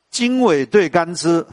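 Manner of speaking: measured verse reading; slow recitation. Shown as a demonstration. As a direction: chant the couplet slowly. Chants couplet slowly. (慢慢吟诵)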